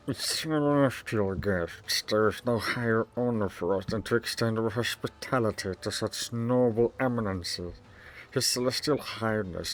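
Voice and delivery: a fawning governor greeting honoured guests; smooth governor. Acted. Voiced governor reads dialogue.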